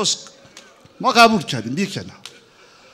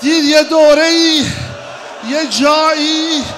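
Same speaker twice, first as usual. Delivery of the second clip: loud, high lament preached at full force; wailing mourning sermon; 9 semitones higher, 7 dB louder than usual. Acted. Preacher wailing Hajar's grief to the mourners, loud and high. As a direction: cry the story out to the mourners.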